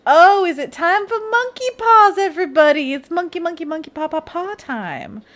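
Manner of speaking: high, loud hyped call to the chat; excited announcement. Acted. Streamer hyping up the chat for a stretch break, excited and loud. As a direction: announce it like a game show host.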